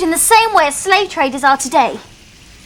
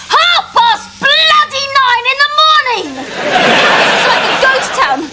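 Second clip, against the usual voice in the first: exasperated; 9 semitones higher, slower than usual. Acted. Complaining about getting up early.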